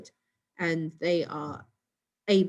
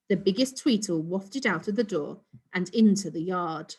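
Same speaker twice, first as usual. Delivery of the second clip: plain reading voice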